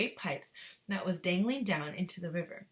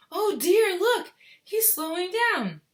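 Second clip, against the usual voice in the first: excited; 12 semitones higher, 10 dB louder, slower than usual. Acted. Character reacts to boy slowing.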